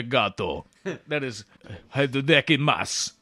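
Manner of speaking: mock Japanese. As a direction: lightly play a Russian attempting Japanese. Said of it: "that'll be a fascinating accent".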